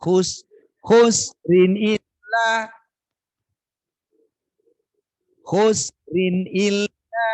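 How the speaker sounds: khusrin illa very slow and loud, each vowel stretched evenly; example of rushed harakat. Shown as a demonstration. Teacher demonstrates the vowel lengths for the student. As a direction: draw out khusrin illa with steady beats.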